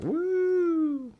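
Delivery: long, high-pitched "woo"; imitated whoop. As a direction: hold one high, steady wooo.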